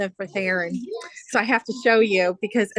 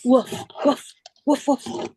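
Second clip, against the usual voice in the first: imitated woofs for a small child; playful dog woofs. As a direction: woof like a toy dog. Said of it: "What's the dog say?".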